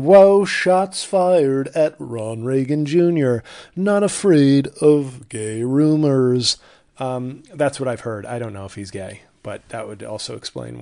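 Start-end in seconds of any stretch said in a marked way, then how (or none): 0.0-3.4 loud, slow put-on ad voice; Ron Reagan Junior. Host impersonating a celebrity on his podcast, slow loud mock voice. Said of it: "Ron Reagan Junior with an early appearance". 3.8-6.6 loud, slow, booming put-on slogan voice; Ron Reagan Junior. Host mocking an atheism ad tagline, loud slow impression. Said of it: "that was the toned down one he's doing now".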